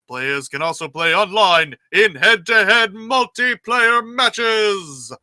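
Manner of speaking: booming trailer announcer, loud and big. Shown as a demonstration. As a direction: sell it like a game trailer, loud. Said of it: "do you want my announcer voice".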